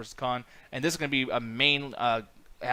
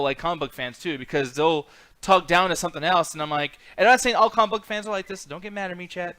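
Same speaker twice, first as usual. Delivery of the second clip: usual speaking voice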